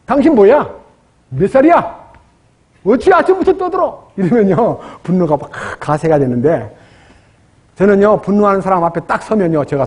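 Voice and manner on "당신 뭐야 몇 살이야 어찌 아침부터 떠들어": high, snapping mock quarrel; angry retort. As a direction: hurl it back at the rude man. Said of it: "막 쌍소리로 대들고 욕하는 사람한테". (높은 소리로 쏘아붙이는 흉내)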